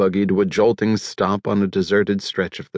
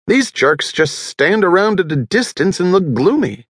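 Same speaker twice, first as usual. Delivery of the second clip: animated rant; 9 semitones higher than usual. A narrator's reading. Character rants about locals.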